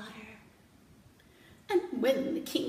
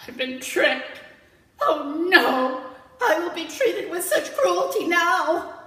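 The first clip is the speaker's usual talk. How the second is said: dismayed jellyfish; 6 semitones higher, 10 dB louder than usual. Acted. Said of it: "Jellyfish realized".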